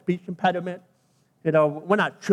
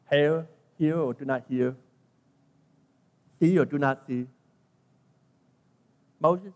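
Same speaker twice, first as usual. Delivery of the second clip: God's response; 4 semitones lower, 5 dB quieter than usual. Acted. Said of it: "look how God responded".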